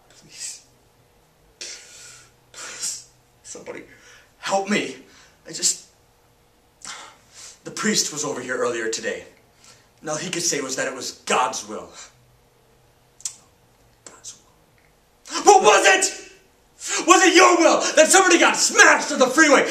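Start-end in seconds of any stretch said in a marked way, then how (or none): 0.1-3.8 voiceless, desperate begging; whispered plea. Acted. Bereaved teenager begging for help, whispered plea. 14.0-15.4 soft, voiceless echo; whispered disbelief. Acted. Grieving teenager echoing the priest, whispered disbelief. Bitterly repeats priest's words.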